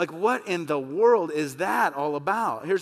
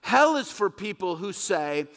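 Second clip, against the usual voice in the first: ordinary talk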